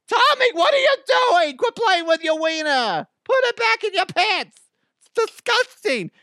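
high, loud mock-mom shriek; scolding mama voice. Mom scolding little Tommy, high mock mama yell. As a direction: yell at your kid in a hammed-up mom voice. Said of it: "when I do my mama voice".

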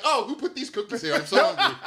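high, loud, delighted mock African accent; African parent impression. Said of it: "hear someone emulate their African parent".

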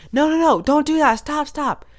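a coworker shouting to stop from close by; coworker calling out. Retold or imitated. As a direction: mimic her high warning call. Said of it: "the voice goes".

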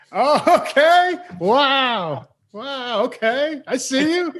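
high, stunned wow; amazed. Real, not acted. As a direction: gasp at the shout, impressed. Stunned by partner's shout.

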